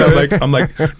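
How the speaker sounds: ordinary talk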